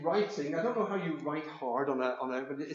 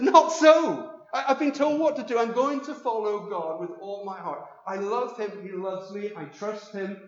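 Elizabeth's firm reply, higher and louder; defiant Elizabeth; 4 semitones higher, 10 dB louder than usual. Acted. Elizabeth refusing the crowd, loud and high. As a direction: stand your ground, loud and high.

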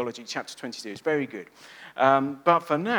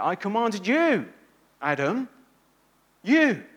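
slow, louder, YOU hammered home; emphatic God; 3 semitones higher, 4 dB louder than usual. Acted. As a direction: stress each "you" as a divine command. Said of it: "God is very emphatic there".

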